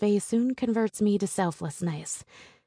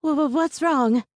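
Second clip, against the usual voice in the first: stammering; 7 semitones higher, 6 dB louder than usual. A narrator's reading. Stammering teacher rushes outside.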